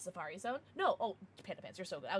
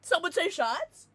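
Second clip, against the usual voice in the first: eager perk-up; 7 semitones higher, 8 dB louder, slower than usual. Real, not acted. Streamer perks up at shot suggestion.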